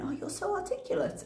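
ordinary delivery

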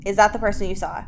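ordinary talk

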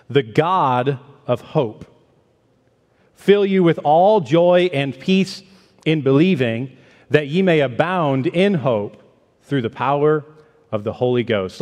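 slow, solemn reading of the verse; scripture reading. Acted. Preacher reading the verse aloud to the church, slow and solemn. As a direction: draw each phrase out with reverence.

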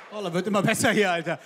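usual speaking voice

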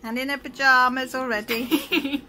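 loud laughing delight. Real, not acted. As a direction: laugh through the words. Laughing as daughter appears.

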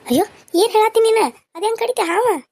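high, loud shocked outcry; alarmed cry. Acted. Mother hearing of the snakebite, cries out high and loud.

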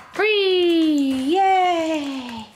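loud bright release cue; excited release call. Real, not acted. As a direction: call it out happily. Releasing dog from stay.

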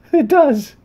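high, loud laughing through the words; thrilled it works; delighted laugh. Real, not acted. Head lights up, delighted.

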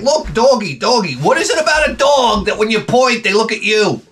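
high, excited voice to the dog; high excited baby talk to the dog. Retold or imitated. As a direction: point it out eagerly to the dog. Pointing things out to dog.

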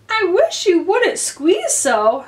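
high, slow grumbling little character; complaining Dormouse. A narrator's reading. Dormouse to Alice squeezed beside it, high complaint.

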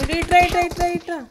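loud urgent call to a teammate. Real, not acted. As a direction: shout him over, come here. Calling a teammate over in game. (సహచరుడిని గట్టిగా పిలుస్తూ)

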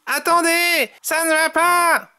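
high-pitched exasperated character voice; annoyed Tom. A narrator's reading. Tom scolds the mixed-up rabbits, high annoyed voice. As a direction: protest high, losing patience. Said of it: "s'énerve Tom".

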